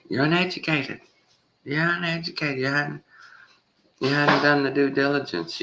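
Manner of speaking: high, sneering put-on voice, looking down on them; mock condescending scientist. Mocking smug scientists who scold flat earthers, high and sneering.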